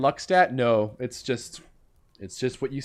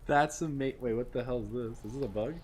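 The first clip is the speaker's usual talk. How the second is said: quiet; 3 semitones lower, 10 dB quieter than usual. Real, not acted. Confused at game.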